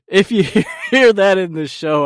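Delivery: can't get through it without laughing. Real, not acted. Host apologizing to listeners while cracking up.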